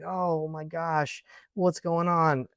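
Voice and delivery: slow, bewildered "oh my gosh" from an imitated lady; startled lady. A lightly voiced older lady, surprised to find strangers at her door. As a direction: mildly act out a confused woman stepping out.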